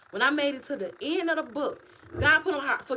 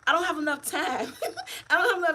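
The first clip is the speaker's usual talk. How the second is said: laughing; 7 semitones higher than usual. Real, not acted. Laughing while saying busy.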